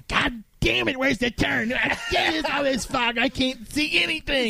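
high, strained mock shouting; imitated griping rant. Driver griping that he can't see in fog, high imitated shout. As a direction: imitate a driver hollering in frustration, laugh creeping in. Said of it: "proceeds to bitch the entire way home".

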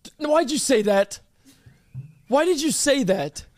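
high-pitched, tearful protest; on the verge of crying. Real, not acted. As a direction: plead as if about to cry. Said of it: "You sound like you're crying".

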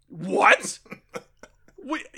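high, disbelieving what; incredulous. Real, not acted. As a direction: squeak out disbelief. Disbelief at the plot.

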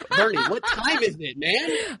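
pleading questioner, voice up high; exasperated interviewer. Retold or imitated. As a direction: push him for a straight answer. Impatient questioner in the bit.

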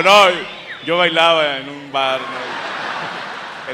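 loud, drawn-out joking reply; hammed-up loud mock answer. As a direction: belt it out slowly as a jokey made-up answer. Comic mock quote of the guest.

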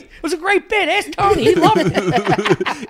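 mock innocent protest. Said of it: "And I was like".

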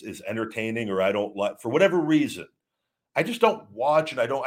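ordinary delivery

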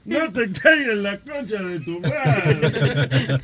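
very slow through laughter; slow, laughing final version. Shown as a demonstration. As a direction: drag it out, cracking up. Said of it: "Pero dilo más lento".

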